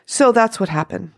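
usual speaking voice

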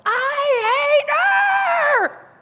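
long, drawn-out scream at full power. Retold or imitated. Grieving teen screaming at her dad. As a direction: scream it with everything. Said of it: "So I just screamed".

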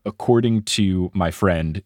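normal talking voice

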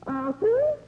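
loud, high call of a name across the house. Acted. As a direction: call him from the next room. Calling him from another room.

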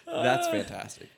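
high, slow words through laughter; laughing too hard to talk; delighted laughter over the words. Real, not acted. Listener cracking up at story.

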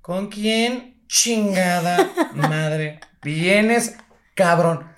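extremely drawn out, pausing between words; slow scolding wife. Retold or imitated. Wife warning husband not to come home, very slow scolding. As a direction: spell out the threat word by word.

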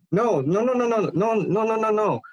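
high, rapid-fire retold refusal; acted panicky string of denials; alarmed protest. Retold protest at news of mom.

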